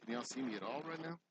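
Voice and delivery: ordinary talk, nothing marked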